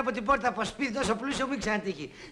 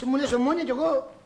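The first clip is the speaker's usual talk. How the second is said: ordinary delivery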